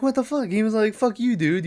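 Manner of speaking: high-pitched, affronted comeback; mock indignant protest. Huey answering Dewey's accusation that it's him, in mock indignation.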